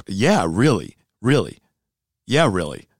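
a blunt judge saying really, really with a falling end; firm comeback. Acted. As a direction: shut the complaint down hard. Judge firing back at athlete.

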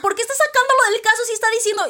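high, fast, exasperated outburst; mock exasperated. Fan voicing her frustration at the scene, high and fast. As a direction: blurt it out high and quick, baffled. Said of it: "sí sentí ese de".

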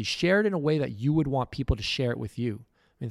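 normal talking voice

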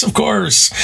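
settling a little but still high and eager; smiling reassurance. Retold or imitated. Teacher reassuring his student with a lingering put-on smile. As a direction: keep the forced smile going. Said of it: "you put on that smile".

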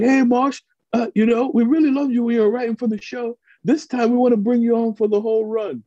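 high, put-on English accent; imitating an English accent. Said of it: "I hear this English accent".

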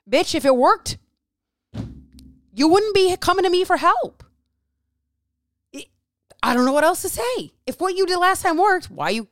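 fed-up coach yelling at clients who keep quitting; exasperated rant. Real, not acted. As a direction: let them have it, full exasperation. Ranting at clients who quit.

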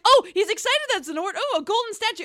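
high, loud, rising excitement; excited bellhop. Retold or imitated. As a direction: gush over a golden statue. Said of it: "The bellhop's like".

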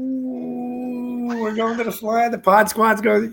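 high, slow, gushing "ooh"; mock excitement. Teasing co-host about the Flyers trip, high and gushing. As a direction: gush like a giddy fan.

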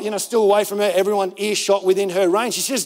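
ordinary talk

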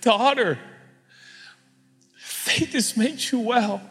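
high, slow, tender blessing; delighted Jesus. Acted. Jesus to the healed daughter, tenderly delighted. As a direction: say it slowly through a grin, high and affectionate. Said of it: "Jesus gets a big grin on his face".

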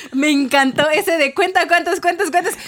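giggling high through the words; laughing. Real, not acted. Laughing as she recalls the counting game. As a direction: laugh while retelling the game.

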